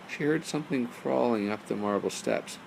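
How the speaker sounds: usual speaking voice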